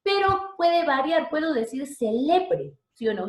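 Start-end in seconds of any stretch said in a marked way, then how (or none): 1.9-2.7 CELEBRE as ce-LE-bre, drawn out; middle-syllable stress. Shown as a demonstration. Teacher shifting the accent for students, stress on LE. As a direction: lean slowly on LE in CELEBRE. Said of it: "Se quedó en el medio".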